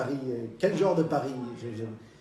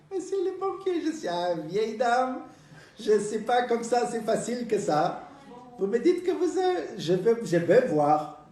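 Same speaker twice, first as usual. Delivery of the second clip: put-on old lady voice; 6 semitones higher, slower than usual. Old lady demands proof.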